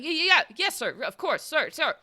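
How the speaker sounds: high, flustered stammering; stuttering rookie. Acted. Rookie agrees with his boss, high nervous stutter. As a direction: stumble eagerly over repeated words. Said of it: "Stop stuttering".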